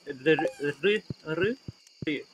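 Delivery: demonstrating a sound between L and R; tapped L-and-R blend, tried out repeatedly; trying out the Japanese ru. Shown as a demonstration. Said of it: "combination of an L and an R".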